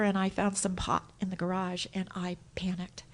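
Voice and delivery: hushed whisper of panic; soft unvoiced admission. Real, not acted. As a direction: breathe it out like a secret.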